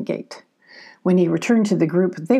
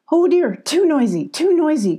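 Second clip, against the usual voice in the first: high-pitched fussing alarm; fretful cry; 8 semitones higher than usual. A narrator's reading. Billy frets at the noisy reunion, high cry. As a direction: fret aloud over the racket. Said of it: "cried Billy".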